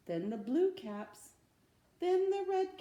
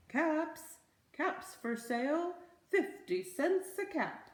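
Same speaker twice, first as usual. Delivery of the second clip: higher, louder street cry; peddler calling out; 7 semitones higher, 4 dB louder than usual. Acted. Peddler calling his caps for sale, higher and louder. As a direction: call your wares down the street.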